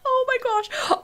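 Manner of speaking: high, small-voiced emotional gush; overcome. Real, not acted. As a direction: squeak it out, overwhelmed by a wedding. Overcome watching the wedding.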